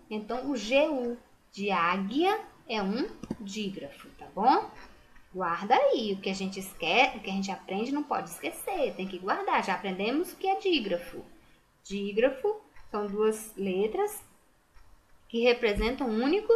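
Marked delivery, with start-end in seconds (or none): none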